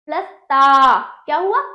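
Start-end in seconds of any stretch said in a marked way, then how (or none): 0.4-1.0 ता loud with long held aa; full ता. Shown as a demonstration. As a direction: draw out the whole ता. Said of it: "पु plus त".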